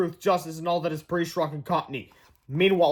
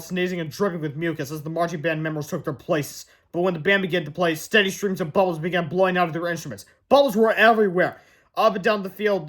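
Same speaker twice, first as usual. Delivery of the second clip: ordinary delivery, nothing marked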